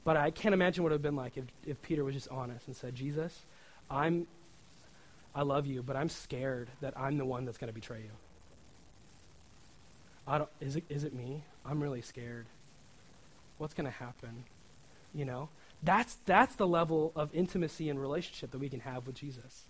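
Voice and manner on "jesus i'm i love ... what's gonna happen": hushed scared confession. Pretend. Peter's imagined scared confession.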